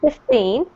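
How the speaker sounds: put-on fifteen said with the stress on TEEN, rising. Shown as a demonstration. Teacher showing students number stress, stress on TEEN. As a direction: hit the second syllable of fifteen. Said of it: "the emphasis of the words".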